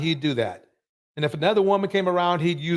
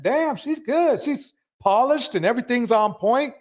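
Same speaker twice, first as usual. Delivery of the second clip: impressed quote; 7 semitones higher than usual. Retold or imitated. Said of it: "I said".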